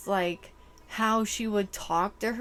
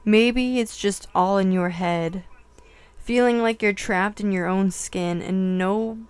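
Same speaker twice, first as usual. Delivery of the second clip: lyric reading; 7 dB louder than usual. Acted. Reads song lyrics aloud.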